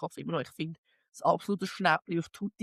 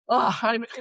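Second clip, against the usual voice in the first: usual speaking voice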